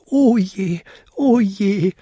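hohes, klagendes Koboldstimmchen; jammernd. A narrator's reading. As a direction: jammere mit deutlich erhöhter Stimme. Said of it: "jammerte er". (jammernd wehklagen)

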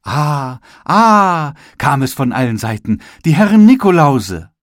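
high, loud delighted ahs; excited admiration. Acted. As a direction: gush over the gingerbread men. Onlookers admire the Nikolause.

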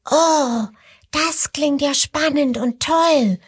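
high, delighted little exclamation; thrilled by the idea. Acted. Little crocodile hears about the game, high delighted voice. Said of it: "ruft das kleine Krokodil vergnügt".

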